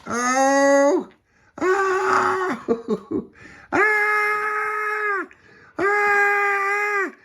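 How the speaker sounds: long, very high wails; acted screams. A narrator's reading. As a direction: scream high while being dragged along. Dragged across the deck.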